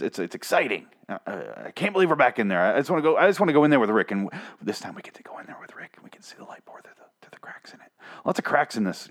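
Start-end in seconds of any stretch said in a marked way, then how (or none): 4.1-7.9 hushed unvoiced whisper. Whispering to viewers as if sneaking into the container with Rick. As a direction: breathe it like you're hiding inside.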